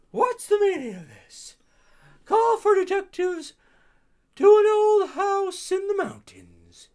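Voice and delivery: an indignant guest demanding answers; high-voiced character.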